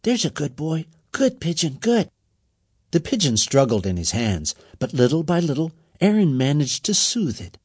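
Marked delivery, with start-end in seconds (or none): 0.0-2.1 soothing. A narrator's reading. Soothing a captured pigeon.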